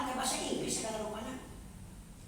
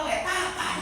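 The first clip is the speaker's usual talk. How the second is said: teacher's voice; 9 semitones higher, slower than usual. Retold or imitated. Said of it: "Ya kata bapak".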